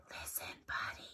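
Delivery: soft unvoiced whisper. A narrator's reading. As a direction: breathe it almost silently. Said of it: "They tried whispering".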